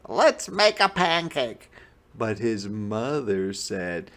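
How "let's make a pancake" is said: put-on high little boy voice; eager boy. A narrator's reading. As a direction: plead like an excited kid. Jack voiced in story.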